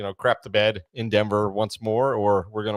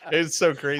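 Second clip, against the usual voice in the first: normal talking voice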